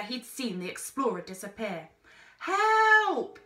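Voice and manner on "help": high, loud cry for help; urgent shout; calling for help. A narrator's reading. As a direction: call out with all you have. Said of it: "he called".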